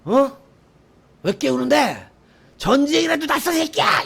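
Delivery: high, loud irritated bark; angry yell. Retold or imitated. Hungover sergeant yelling at young soldier, angry. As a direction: roar at the one who woke you.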